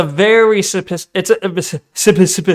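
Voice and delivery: tripping over one word, restarting it; tongue-tied stumbling. Real, not acted. Streamer fumbling a very specific point, tongue-tied. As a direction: stammer helplessly on the first syllable. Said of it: "I can't speak".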